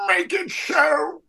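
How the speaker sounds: gargling glass, high and slow; strained old captain's command; Patrick Stewart. Retold or imitated. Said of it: "he sounds like he's gargling glass".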